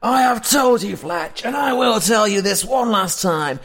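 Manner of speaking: high, loud furious shout; angry yelling. Acted. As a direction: yell it like a final warning. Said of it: "you hear someone yelling".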